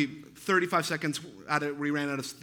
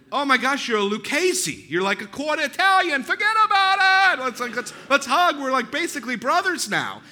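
put-on much higher, much louder overjoyed Chicago accent; excited mobster voice; 8 semitones higher, 7 dB louder than usual. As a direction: gush like a long-lost brother. Neighbor's excited embrace retold.

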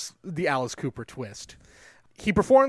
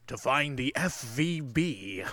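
George Burns impression; 4 semitones lower, 6 dB quieter, slower than usual. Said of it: "hearing George Burns saying".

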